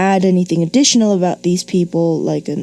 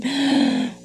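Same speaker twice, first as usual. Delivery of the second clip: mock gasp for breath; comic gasp after running out of breath; gasp for air; 7 semitones higher, 5 dB quieter than usual. Shown as a demonstration. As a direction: gulp air after the rushed run-on. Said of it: "Where do you breathe?".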